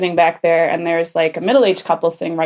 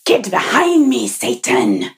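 dramatic, forceful Jesus; 4 dB louder than usual. Retold or imitated. Teller mimics church-play Jesus rebuking Satan, dramatic and forceful. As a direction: declaim it like an overblown passion-play lead. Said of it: "he was a little dramatic and arrogant".

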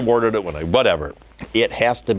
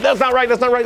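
very high, rapid-fire alarm; mock panic; 4 dB louder, faster than usual. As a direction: squeal it like a panicking director. Acting out panic watching first cut.

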